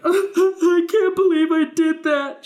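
high, loud, slow distraught wail; guilty mom. Retold or imitated. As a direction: wail it like a mother who hurt her kid. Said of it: "my mom was like".

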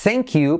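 slow "thank you" with an E, "thenk"; A becomes E. Shown as a demonstration. Teacher repeating the model, "thank you" as "thenk you". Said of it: "la A se convierte en una E".

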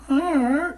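put-on high baby "ah" sound; a bright infant vocal "Ah"; baby's ah. Shown as a demonstration. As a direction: coo like an infant. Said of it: "when you hear a baby go".

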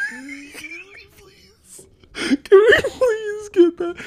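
high, laughing, begging; laughing plea. Real, not acted. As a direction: beg while cracking up.

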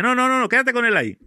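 insistent retold refusal. Miguel insisting he stay with the man, loud and high. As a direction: push him back, insisting he stay.

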